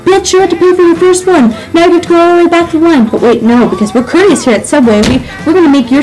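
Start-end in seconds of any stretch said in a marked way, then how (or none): 0.1-3.1 high, fast, exasperated rant; angry rant. Acted. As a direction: tell the customer off, shrill. Ranting at the customer.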